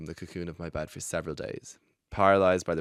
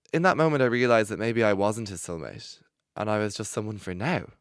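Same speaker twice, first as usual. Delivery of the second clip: ordinary talk, nothing marked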